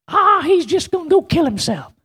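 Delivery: high wailing granny; grandmother's voice. Acted. Preacher imitating grandmother who lost it on the phone, high wail. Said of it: "but she said".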